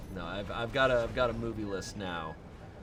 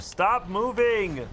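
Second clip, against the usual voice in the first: yelling at the boss; 8 semitones higher, 5 dB louder, much slower than usual. Real, not acted. Yelling at game enemy.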